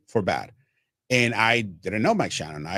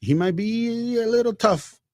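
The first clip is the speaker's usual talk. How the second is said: imitating Ann Carroll; 6 semitones higher than usual. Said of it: "she's like".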